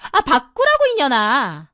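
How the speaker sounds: high-pitched irritated outburst, then dropping; exasperated snapping. Acted. Younger sister insisting on the phone being passed, exasperated. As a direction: start high in exasperation and curse her.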